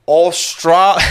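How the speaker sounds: put-on a failed stab at an Australian accent, cut off by a laugh. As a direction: start saying Australia in a bad Aussie accent. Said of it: "I really should be able to do a good Australian accent".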